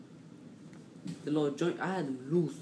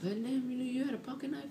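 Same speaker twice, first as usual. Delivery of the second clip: high mock teacher; 11 semitones higher than usual. Gym teacher questioning the kid, high mock voice. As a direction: squeak it like a startled teacher. Teacher asks him in story.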